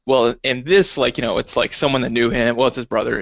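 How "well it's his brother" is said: ordinary talk, nothing marked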